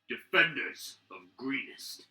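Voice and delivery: villain taunting the keep's defenders; half-dragon warlord. Acted. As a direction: gloat down at the defenders. Half-dragon hails the keep.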